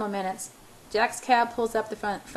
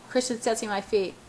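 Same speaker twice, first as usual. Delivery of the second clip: ordinary delivery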